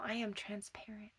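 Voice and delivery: whispered confession. Real, not acted. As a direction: let it out in a hush. Sudden whisper mid-sentence.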